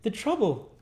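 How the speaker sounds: put-on very high boy's exclamation; high-pitched, eager boy voice; crying out as Oliver. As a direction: exclaim as an earnest little boy. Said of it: "cried Oliver".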